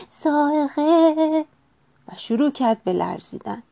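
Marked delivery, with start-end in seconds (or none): 0.1-1.5 high, drawn-out scared shout; frightened cry. A narrator's reading. Franklin sees lightning at the door, frightened cry. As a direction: cry out the word slowly on a high held pitch. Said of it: "فرانکلین فریاد زد".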